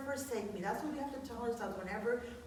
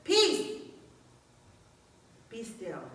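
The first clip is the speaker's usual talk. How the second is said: commanding Peace; 5 dB louder, much slower than usual. Retold or imitated. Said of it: "Jesus just says".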